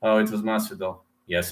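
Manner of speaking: ordinary talk, nothing marked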